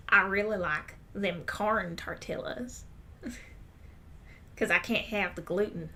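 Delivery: slow mock Texan twang; drawled, put-on Southern accent. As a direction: imitate an older Southern customer ordering. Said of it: "the people with accents when they try to speak Spanish".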